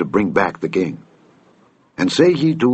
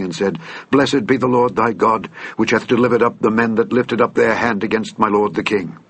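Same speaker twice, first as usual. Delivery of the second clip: plain reading voice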